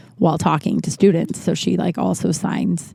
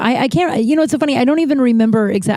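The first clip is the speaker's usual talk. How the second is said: normal talking voice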